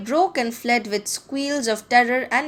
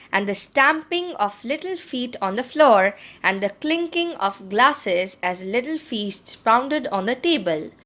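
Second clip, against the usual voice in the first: ordinary delivery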